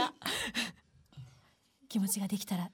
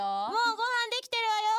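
fast, higher nagging mom; nagging mother; 9 semitones higher, much faster than usual. Acted. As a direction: hurry her up like a fed-up mom. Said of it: "起こしに来るお母さん役".

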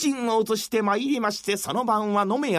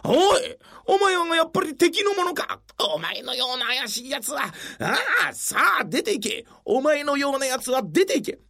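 angry shouting; 5 semitones higher than usual. Acted. Official angrily throws him out.

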